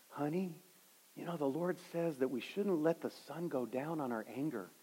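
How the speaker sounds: soft and gentle, gingerly persuasive; gentle gracious. Retold or imitated. Husband gently coaxing his steaming wife, soft and gracious. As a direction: tiptoe around an angry wife gently. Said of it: "trying to be real gentle, really gracious".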